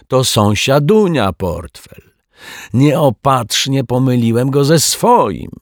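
slow, high, loud jovial explanation; hammed-up apology. A narrator's reading. Rogue hands the wallet back, with hammed-up joviality. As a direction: overplay the innocent mistake.

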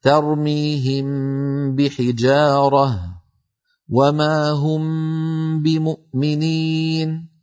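slow tajweed recitation, meem of ترميهم hummed before بحجارة; Quranic recitation with ghunna. Shown as a demonstration. Teacher recites the ikhfaa examples to students, slow and nasal. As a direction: recite slowly, hiding the meem with a nasal hum. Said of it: "نحو".

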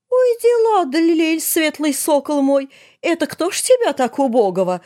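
high, sing-song wifely coo. A narrator's reading. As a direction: coo over him in mock folk style. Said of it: "проворковала Арина". (воркуя, высоко и нараспев)

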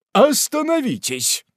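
high-pitched call to halt; high urgent stop; commanding cry. A narrator's reading. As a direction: stop him at the door. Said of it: "закричал мистер Пиквик".

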